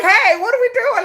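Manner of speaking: high, loud, laughing mock idol voice; laughing nonchalant idol. Fan imitating idols lost on stage, high and laughing. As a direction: giggle through it like a lost idol. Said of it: "wandered around on stage like".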